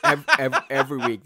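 laughing through the words; can't stop laughing. Real, not acted. Laughing at cohost's chair antics.

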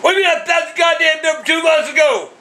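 high, furious yell down the phone; dad yelling at the cable company agent, furious shout; angry shout. Real, not acted. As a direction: blast the agent with disbelief.

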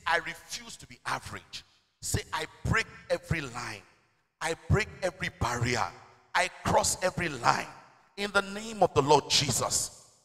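slow, loud, low-pitched proclamation; forceful declaration. Real, not acted. Preacher leading the congregation in declarations, loud, slow and emphatic. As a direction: build to full-force preaching.

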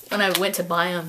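normal talking voice

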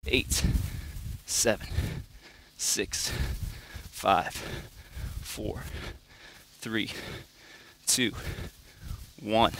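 quiet, strained counting mid push-up; counting workout reps. Real, not acted. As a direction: count reps under your breath while working. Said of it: "I'm gonna count it out for you too".

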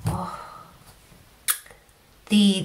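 usual speaking voice

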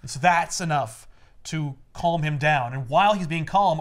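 ordinary talk, nothing marked

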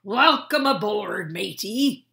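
loud jolly sailor greeting; captain greeting his friend aboard, loud and hearty; hearty shout. Acted. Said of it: "Captain Delbert shouted".

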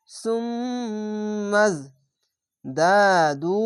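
slow, long-drawn chanted syllables; tajweed recitation. Shown as a demonstration. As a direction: stretch every sound in recitation. Recites the word.

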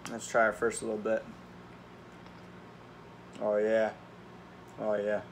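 speaking between bites; chewing while talking; mouth partly full. Real, not acted. Seen 0: Both lift noodle forks to mouths; unclear which one speaks.

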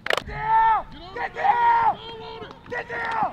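high, loud urgent yell; yelling from the stands. Real, not acted. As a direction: scream it across the field.

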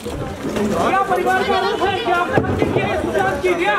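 high, insistent reporter's shout; shouted question. Real, not acted. Reporter confronting the accused about the family's allegation, shouted question. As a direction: shout the question over a jostling press pack.

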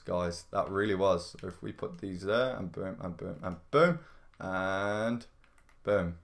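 ordinary talk

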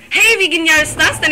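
high, loud burst of delight. Real, not acted. Gamer solves a puzzle by guessing, loud delight. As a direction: exclaim it, thrilled by your lucky success.

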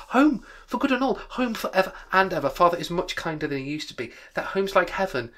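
put-on high, loud, overjoyed little girl; gleeful child. A narrator's reading. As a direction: gush like a delighted young sister. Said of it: "child brimful of glee".

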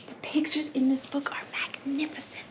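ordinary delivery

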